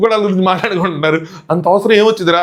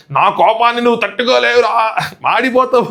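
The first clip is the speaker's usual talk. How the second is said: higher, slow mock menacing threat; Nataraju master's threat; 5 semitones higher than usual. Quoting the master's dialogue to Siva, a hammed-up higher mock threat. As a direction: deliver it like a hammed-up film villain's warning. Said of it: "ఇక dialogue ఇది".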